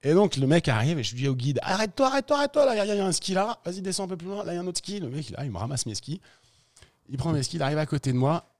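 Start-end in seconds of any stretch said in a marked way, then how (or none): 1.6-5.0 high, loud urgent calls; calling out. Retold or imitated. Skier after the avalanche shouting to the guide, urgent. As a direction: stop the rescuer, pointing out skis.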